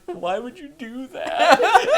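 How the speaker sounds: high, loud, drawn-out protest; mock distressed wail. Dazed man asks why they did that, mock wail. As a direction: stretch it into a comic high-pitched complaint. Hurt character protests, retold.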